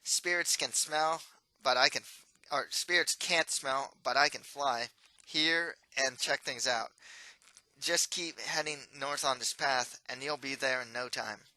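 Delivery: loud, lightly nasal ghost; breezy smug helper; nasal sarcastic voice. Acted. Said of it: "nasally voice that always sounds sarcastic".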